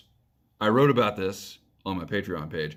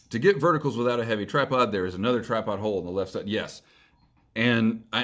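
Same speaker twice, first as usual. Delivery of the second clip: ordinary talk, nothing marked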